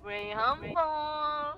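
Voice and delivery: long drawn-out hawker's cry; mock vendor call. Friend pretending to sell Graham Balls, drawn-out vendor call. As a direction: stretch it out like a street seller. Playing street vendor selling snacks.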